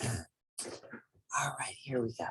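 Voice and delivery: whispered aside before starting; soft whisper. Real, not acted. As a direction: hush it like a quiet aside. Settling in before reading.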